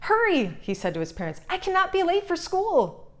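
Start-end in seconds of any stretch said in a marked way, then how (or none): 0.1-0.6 high, eager call to hurry; a kid who can't wait to leave; excited hurry. A narrator's reading. Eager child rushing parents. 1.5-3.1 high, eager call to hurry; a kid who can't wait to leave; excited hurry. A narrator's reading. Eager child rushing parents.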